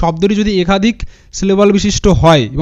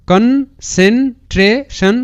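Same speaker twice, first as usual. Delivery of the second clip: very slow, higher, each syllable separate; 5 semitones higher, much slower than usual. Shown as a demonstration. Teacher assembles the split word for students, syllable by syllable. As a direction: sound out concentration in parts with pauses. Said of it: "এরকম করে উচ্চারণটা করবে".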